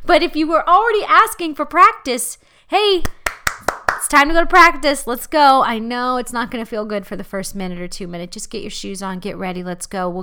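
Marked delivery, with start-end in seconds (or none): 2.7-5.6 loud, fast rallying call. Retold or imitated. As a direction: pump them up and get them moving. Calling self to get up.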